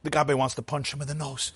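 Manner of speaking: usual speaking voice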